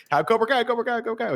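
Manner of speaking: high-pitched, excited hawker's chant; hawking free merch. Retold or imitated. Villains handing out free shirts, high hawker's call.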